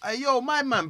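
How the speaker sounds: mock hail to the barber; calling out. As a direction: call to him over the room.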